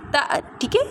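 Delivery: usual speaking voice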